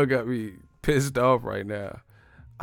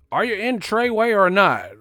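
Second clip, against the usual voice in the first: higher, louder mock interrogation; gang member interrogating; 8 semitones higher, 5 dB louder than usual. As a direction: demand an answer like a gangster. Acted gang member questioning.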